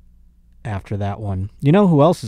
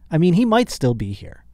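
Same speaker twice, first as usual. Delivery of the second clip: ordinary talk, nothing marked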